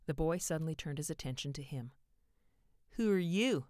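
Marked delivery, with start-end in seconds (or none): 2.9-3.7 put-on loud, raised boyish challenge; belligerent demand. A narrator's reading. Boy turns on the visiting salesman, belligerent. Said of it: "he demanded belligerently".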